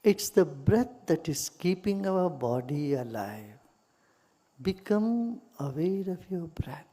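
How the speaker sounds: calm meditation guide with long pauses; guided meditation. Real, not acted. Teacher leading listeners into gratitude for body and breath, meditative. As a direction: guide them gently into stillness, unhurried.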